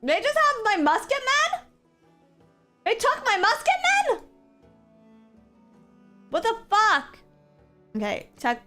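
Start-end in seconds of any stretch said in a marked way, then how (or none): 0.0-7.2 high, loud disbelief; outraged shriek. Real, not acted. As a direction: shriek it out, appalled. Losing units in game.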